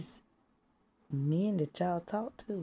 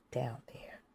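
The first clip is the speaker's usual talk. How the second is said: whispered aside; 5 semitones lower, 9 dB quieter than usual. Real, not acted. Telling the crowd, in a whisper. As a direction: drop to a low whisper. Hushing the rude word.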